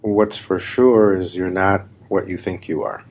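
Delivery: ordinary talk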